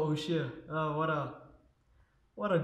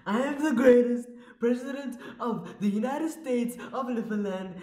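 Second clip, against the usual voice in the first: Trump impression; 6 semitones higher than usual. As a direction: boast like Trump at a rally. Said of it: "Lefa Trump".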